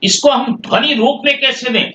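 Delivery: ordinary talk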